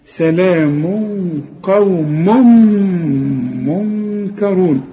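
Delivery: قوم منكرون with the nunation merged into م; idgham recitation. Shown as a demonstration. Teacher to student: slow demo of idgham. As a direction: recite slowly, merging into the م. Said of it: "ندغم هنا".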